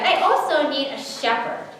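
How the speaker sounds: lifted, upbeat request; enthusiastic. A narrator's reading. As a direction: ask it with keen enthusiasm. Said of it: "still enthusiastic".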